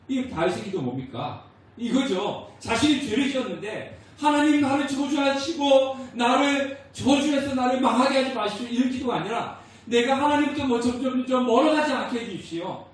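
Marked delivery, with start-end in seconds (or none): 4.2-12.8 higher, louder, pleading prayer; grieving prayer. Retold or imitated. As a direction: plead in sorrow to God. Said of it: "애통하면서 기도했다".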